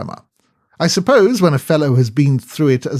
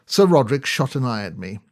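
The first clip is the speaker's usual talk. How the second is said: ordinary delivery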